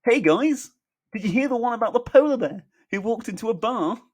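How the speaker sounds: high, loud, excitable joker; hammed-up squeaky-high cheerful voice; Michael piping up. A narrator's reading. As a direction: pipe up eagerly with a joke. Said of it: "piping up".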